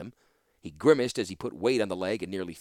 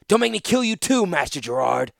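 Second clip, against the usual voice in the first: high, loud rasp through a hurt throat; rasped threat; 9 semitones higher, 9 dB louder than usual. Acted. Wounded student rasping a threat at his master. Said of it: "he rasped".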